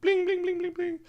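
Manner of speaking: very high pinging noise, fading; gunshot pings. Retold or imitated. Narrator imitates bullets pinging during the shootout, very high. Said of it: "and they were like".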